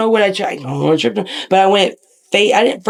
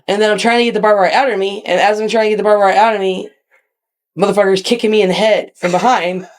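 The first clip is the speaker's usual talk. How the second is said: normal talking voice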